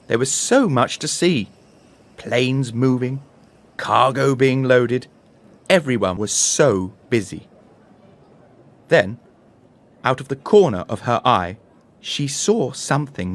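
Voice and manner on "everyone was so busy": ordinary delivery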